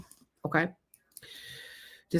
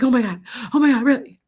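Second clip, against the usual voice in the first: imitating an amazed reaction, higher and louder; mock astonishment; 5 semitones higher, 5 dB louder, faster than usual. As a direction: act out a stunned listener. Retelling a viewer's astonished reaction.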